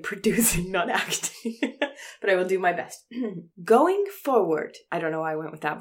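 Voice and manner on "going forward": high, loud, drawn-out put-on voice; Elon Musk. Actor told to channel a tech boss for the listeners, put-on voice. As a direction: announce it slowly in a pitched-up character voice. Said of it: "do an Elon Musk voice and attitude".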